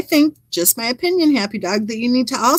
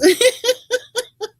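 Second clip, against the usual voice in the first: a burst of high real laughter; 13 semitones higher than usual. Real, not acted. Still laughing at comment.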